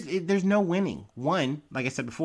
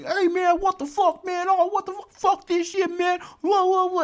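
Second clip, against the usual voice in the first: high-pitched, louder mock yelling of outraged dudes; imitated crowd yelling; 4 dB louder than usual. Host imitating dudes in the tournament video, mock yelling. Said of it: "all these dudes just yelling".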